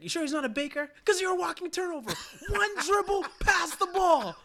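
high, loud teasing coach voice; mock coach taunt. Coach mocking Darnell's over-dribbling, high loud teasing. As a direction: needle the kid with a setup question. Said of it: "He's like".

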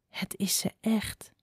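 hushed, slow whisper; barely voiced, stunned recognition; awed whisper. A narrator's reading. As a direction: whisper it slowly in dread. Frightened mother recognises the fox.

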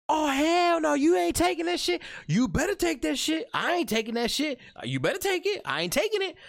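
squabble back and forth in high voices; shrill mock argument. Acted quarrel over vaccine.

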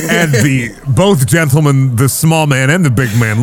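ordinary talk, nothing marked

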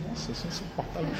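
soft, stunned self-talk; muttered to oneself in disbelief; quietly taken aback. Retold or imitated. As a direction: drop to a hushed, inward voice. Taken aback on hearing the verdict.

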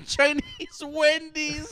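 laughing high and slow. Real, not acted. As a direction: crack up through the words. Laughing at a joke.

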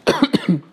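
short sharp cough; clearing a tickle mid-read; real cough. Real, not acted. Reader breaks off reading to cough.

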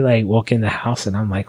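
ordinary talk

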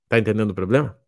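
ordinary talk, nothing marked

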